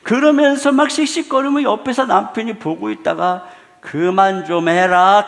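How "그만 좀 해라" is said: slow loud scolding. Retold or imitated. Husband scolds wife.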